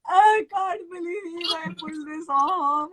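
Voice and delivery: high, slow, crying out in disbelief; overwhelmed sobbing joy, retold; tearful disbelief. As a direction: choke it out through happy tears. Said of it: "I started crying on the phone".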